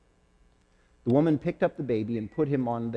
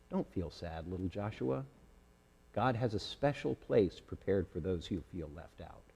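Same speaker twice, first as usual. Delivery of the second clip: gentle comfort; 4 semitones lower, 8 dB quieter than usual. Acted. Friend comforts sad lamb.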